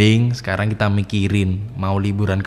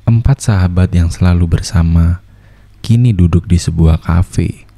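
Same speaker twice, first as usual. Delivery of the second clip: steady storyteller voice setting the scene; story narration; 3 semitones lower, 6 dB louder than usual. A narrator's reading. Narrator introducing four friends, calm storytelling. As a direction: open the tale like a radio drama narrator.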